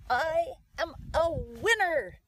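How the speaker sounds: big, drawn-out proud proclamation; loud affirmation. Shown as a demonstration. Coach demonstrates an affirmation to say out loud, loud and emphatic. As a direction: declare it loud, high and slow. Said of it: "you have to say it loud".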